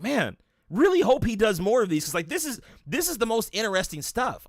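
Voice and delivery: excitedly quoting his own reaction; retold excitement. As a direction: gush like a fan reliving the find. Acts out his excited past reaction.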